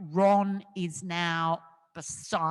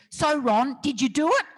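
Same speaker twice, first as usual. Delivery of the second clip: blunt reporter question; 5 semitones higher, 9 dB louder than usual. Retold or imitated. Puts a microphone in his face.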